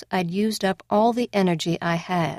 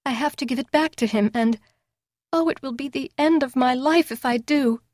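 tearful; 6 semitones higher than usual. Acted. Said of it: "Drunk and sobbing".

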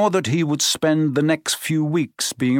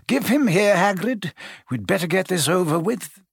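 lightly voiced wise old wizard, raised pitch; gentle, amused old headmaster, a touch higher; kindly old headmaster; 6 semitones higher than usual. Dumbledore speaks in story.

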